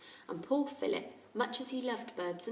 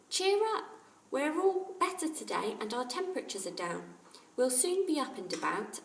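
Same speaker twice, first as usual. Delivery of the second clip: put-on higher young boy; boy's voice; 6 semitones higher, 4 dB louder than usual. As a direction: cheer up the parrot. Jack voiced in story.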